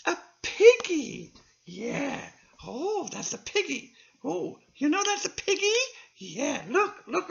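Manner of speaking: high, loud puppet squeal over the piggy; thrilled little puppet discovering a pig; excited puppet. Acted.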